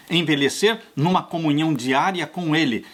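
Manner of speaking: ordinary talk, nothing marked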